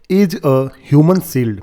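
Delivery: "Is a human shield" read very slowly, word by word; slow dictation-style read; slow quoting. Shown as a demonstration. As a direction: drag each word out for learners. Teacher re-reads headline phrase.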